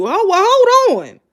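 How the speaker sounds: high, loud, stretched-out objection; indignant disbelief. Real, not acted. Objects to the quoted remark.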